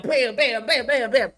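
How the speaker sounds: mock impact noises. Imitating T-sphere hits.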